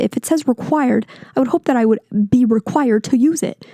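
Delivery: normal talking voice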